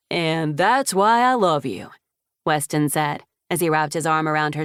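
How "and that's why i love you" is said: fond. A narrator's reading. Weston embraces Isabella fondly.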